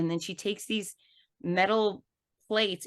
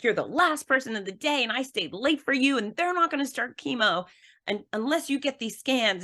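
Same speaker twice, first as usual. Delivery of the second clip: scolding nurse; 8 semitones higher than usual. Retold or imitated. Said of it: "she's yelling at me".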